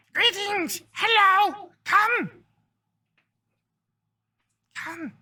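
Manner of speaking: very high, loud kobold squeal; excited kobold. Acted. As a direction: call customers over in a high creature voice. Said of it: "And this kobold goes".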